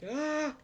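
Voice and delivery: high drawn-out cry; real yelp of alarm; frightened scream. Real, not acted. Player under attack, frightened high scream.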